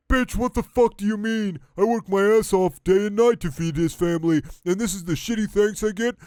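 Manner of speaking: indignant starfish yelling back; angry Patrick voice. Acted. Starfish yelling at his partner about family, angry cartoon voice.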